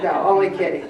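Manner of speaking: normal talking voice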